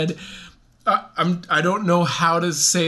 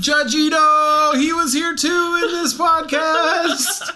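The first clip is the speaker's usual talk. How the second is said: mock excited announcement; 10 semitones higher, 3 dB louder, slower than usual. Joking announcement of celebrity guest.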